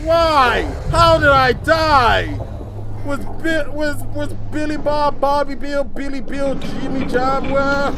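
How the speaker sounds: high, loud frantic shouting; panicking gamer. Real, not acted. Just died, shouting.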